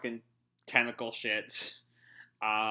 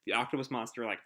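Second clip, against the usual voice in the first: ordinary talk, nothing marked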